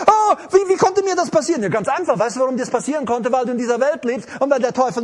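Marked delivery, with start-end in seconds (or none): 0.1-1.6 higher, louder wailing question; hammed-up anxious whine; mock panic. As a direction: lament in an overdone panic. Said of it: "Viele Christen sind immer in Panik".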